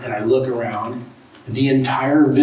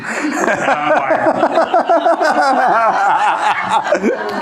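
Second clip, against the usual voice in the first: a long, high burst of real laughter; laughing hard at the memory; loud high laughter; 11 semitones higher, 5 dB louder than usual. Real, not acted. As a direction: collapse into laughter. Laughing after the punchline.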